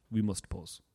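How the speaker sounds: low, soft put-on German accent; hushed imitation of a German villain; German Bond villain. Imitating the caller with a German accent, villain voice. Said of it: "sound like a Bond villain".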